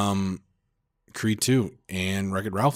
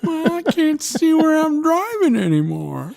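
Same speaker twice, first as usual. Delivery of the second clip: put-on high, slow old man drawl; Jimmy Stewart; 5 dB louder, slower than usual. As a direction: ramble like a doddering grandpa. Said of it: "why do I sound like Jimmy Stewart?".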